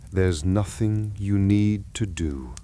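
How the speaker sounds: ordinary delivery, nothing marked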